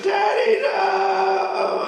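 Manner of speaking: high, loud imitated toddler wail; little girl's cry. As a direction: mimic a panicking two-year-old. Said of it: "my daughter lost her mind and said".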